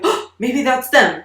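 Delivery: put-on a little kid straining to hear a car; hopeful kid. Child waiting for relatives, hopeful.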